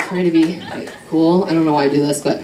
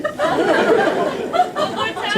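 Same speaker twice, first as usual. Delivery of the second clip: much higher, very slow, mock-anguished; drawn-out high repeat; 3 dB quieter than usual. Comic reliving the blunder, slow and high. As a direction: stretch it out high like a wail.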